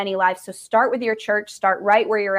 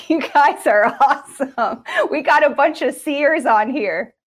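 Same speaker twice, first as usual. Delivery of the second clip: louder, higher, laughing praise; laughing excitedly; 6 semitones higher, 5 dB louder than usual. Real, not acted. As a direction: beam through the words with a laugh. Delighted praise of viewers.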